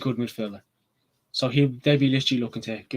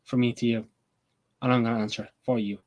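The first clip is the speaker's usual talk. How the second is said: usual speaking voice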